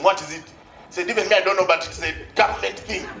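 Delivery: ordinary talk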